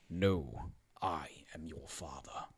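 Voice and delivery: low, very soft breathy villain; Darth Vader. Acted. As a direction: intone it deep and hushed. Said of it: "That's the line".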